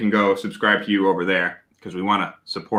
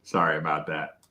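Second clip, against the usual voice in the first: "Sorry about that" said much softer and lower; softer apology; 8 semitones lower, 8 dB quieter than usual. Real, not acted. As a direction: bring it right down for the listener who had to lower the volume. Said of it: "Yeah, I'm pretty loud".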